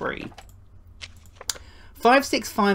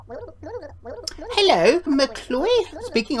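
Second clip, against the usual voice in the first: higher put-on voice answering the phone; 9 semitones higher, much slower than usual. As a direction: pick up the call as a fussy old neighbour.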